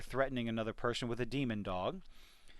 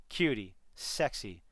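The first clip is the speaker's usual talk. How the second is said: ordinary talk, nothing marked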